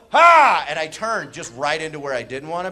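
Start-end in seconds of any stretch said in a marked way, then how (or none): 0.1-0.6 loud startled yelp; sudden high, loud gasp of a cry. Acted. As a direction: blurt a shocked "ha". Acts out startled turn.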